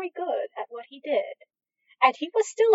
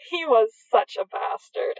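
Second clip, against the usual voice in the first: usual speaking voice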